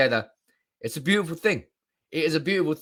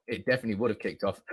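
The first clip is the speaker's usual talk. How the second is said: hiccups; 4 semitones lower, 6 dB quieter, faster than usual. Real, not acted. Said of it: "The hiccups come in".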